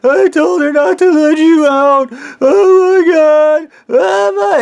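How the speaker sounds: high, loud panicked wailing; over-the-top screechy-high pleading; acted hysterical crying. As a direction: bawl it out in mock anguish, stretching the words. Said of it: "like, bitching and bitching and crying".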